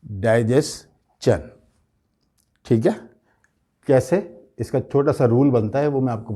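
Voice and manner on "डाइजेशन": "डाइजेशन" slowly as dai-jes, CHUN; slow split "डाइजेशन", die-jes-chun; correct pronunciation. Shown as a demonstration. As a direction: end "डाइजेशन" with ch. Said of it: "चन होगा ये".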